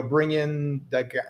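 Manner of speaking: ordinary talk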